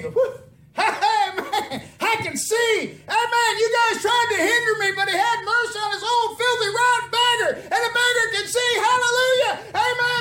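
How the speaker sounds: high, loud ecstatic celebration; exultant holler; jubilant shouting. Acted. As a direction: shout with overwhelmed joy at full voice. Healed blind man rejoices.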